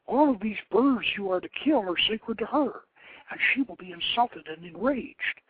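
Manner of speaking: a sister warning of the goddess's wrath; urgent sister. Acted. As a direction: plead harder with rising alarm. Sister warns brother in story.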